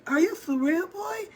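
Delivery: put-on high-pitched kid voice; little brother. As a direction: imitate a child in disbelief. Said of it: "You know how kids talk".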